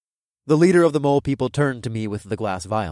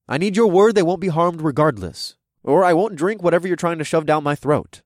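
firm demand; 5 semitones higher, 5 dB louder than usual. A narrator's reading. Hero demands a promise.

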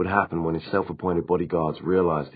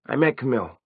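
put-on narrator's higher-pitched voice for a young vampire; a higher character voice, confiding; lightly voiced Simon; 7 semitones higher than usual. A narrator's reading.

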